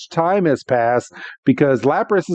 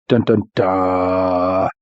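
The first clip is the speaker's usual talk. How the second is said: mock suspense hum; 7 semitones lower, much slower than usual. Suspense while searching notes.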